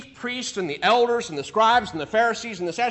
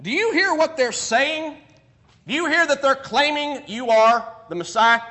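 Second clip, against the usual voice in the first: higher, louder accusing question; indignant priests; 6 semitones higher, 5 dB louder than usual. Retold or imitated. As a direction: press him with outraged disbelief. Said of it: "They ask him".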